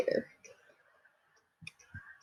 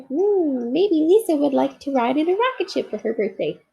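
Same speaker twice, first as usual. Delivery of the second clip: a toy bear marvelling at a new game; delighted little bear; 7 semitones higher than usual. Acted. Corduroy musing.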